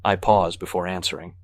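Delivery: usual speaking voice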